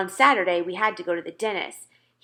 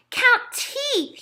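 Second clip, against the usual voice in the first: put-on high, louder toddler voice; little Fudge demanding; 4 dB louder than usual. A narrator's reading. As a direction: demand it like a small boy. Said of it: "he said".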